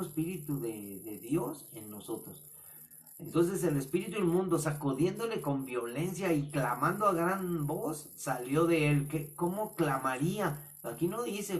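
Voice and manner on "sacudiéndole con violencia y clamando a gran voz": ordinary talk, nothing marked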